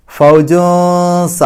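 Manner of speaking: very slow ഫൗജൂസ with a long hidden n before the s; concealed n. Shown as a demonstration. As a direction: recite ഫൗജൂസ high and loud, holding the hum before the last syllable. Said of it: "ഇഖ്ഫാ ചെയ്യുക". (ഫൗജൂസ: സീനിന് മുമ്പ് ഇഖ്ഫാ ചെയ്ത് ഓതുക)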